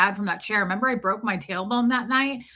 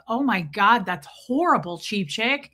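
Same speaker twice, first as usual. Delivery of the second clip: ordinary delivery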